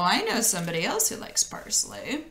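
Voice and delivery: plain reading voice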